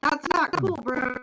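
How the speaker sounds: outraged, high and loud; laughing protest; indignant laughing. Real, not acted.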